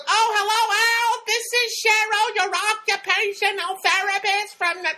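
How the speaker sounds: very high, loud, drawn-out caricature; Cheryl's slow, annoying voice. Retold or imitated. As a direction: mimic an irritating slow caller at a piercing pitch. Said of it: "so slow in talking".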